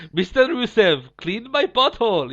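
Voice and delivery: hammed-up bad Russian accent, last words drawn out; Borat. Co-host clowning as the attorney to Rusev, mock Russian accent. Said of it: "He sounded like Borat".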